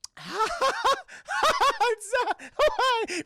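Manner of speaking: high, loud, extended wild laughing cry; hysterical cackle. Acted. Charlie face to face with the Joker, hysterical. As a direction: let loose a manic high cackle.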